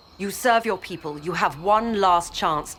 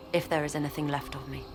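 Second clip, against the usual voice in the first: resigned; 5 semitones lower, 7 dB quieter, much faster than usual. Acted. Resigned parting before confronting master.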